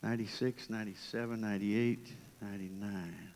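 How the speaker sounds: a quiet, measured count of sheep; a shepherd checking his flock one by one; shepherd counting softly. Retold or imitated. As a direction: count each stone off under the breath. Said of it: "so he's going".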